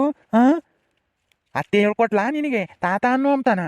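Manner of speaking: normal talking voice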